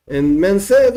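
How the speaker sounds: ordinary delivery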